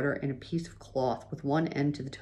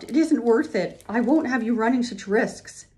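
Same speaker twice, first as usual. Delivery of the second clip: high, upset insistence; distraught wife, lightly acted sobbing; tearful protest; 7 semitones higher, 4 dB louder than usual. A narrator's reading. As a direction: protest as if in tears, pitch raised. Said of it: "Ma sobbed".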